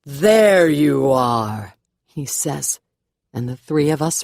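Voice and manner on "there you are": loud, drawn-out call across a hall; ringing call. A narrator's reading. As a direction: let it ring out, very slowly. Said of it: "Reverend's voice that rings out".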